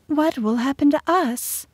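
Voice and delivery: high-pitched, anxious female character voice; worried queen. A narrator's reading. Queen dabbing her eyes asks their fate, worried. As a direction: ask it high and fretful.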